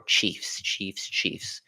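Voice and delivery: whispered practice of "Chiefs" around a retainer; wearing a retainer. Real, not acted. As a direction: test the "ch" in "Chiefs" softly with a retainer in. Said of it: "commentating with the retainer in".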